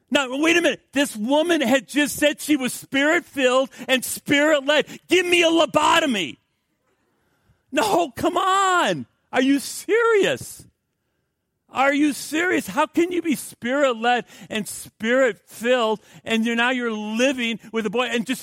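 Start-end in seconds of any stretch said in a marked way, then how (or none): 0.1-4.9 high, loud outraged preaching; preaching at full force. Acted. Preacher shouting his disbelief at the congregation. 5.1-9.1 loud, high exasperated shout; disbelieving shout. Real, not acted. Preacher shouting at the woman's claim, exasperated. 9.3-16.5 high, loud outraged preaching; preaching at full force. Acted. Preacher shouting his disbelief at the congregation.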